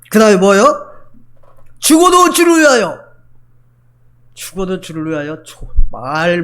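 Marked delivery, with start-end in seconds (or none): 1.8-3.0 "죽어도" shouted high and loud; loud slogan. Acted. Preacher crying the slogan to the church, loud and high. 4.3-5.7 mock the empty slogan quietly; mocking mutter. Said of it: "말은 잘하네".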